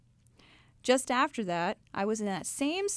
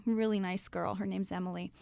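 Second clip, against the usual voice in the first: usual speaking voice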